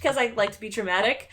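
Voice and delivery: normal talking voice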